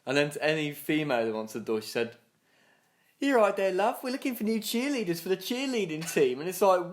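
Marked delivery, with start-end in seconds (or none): 3.2-6.4 high, chirpy pitchman voice; doorstep sales pitch. Retold or imitated. Asking a woman at the door for cheerleaders, sales patter.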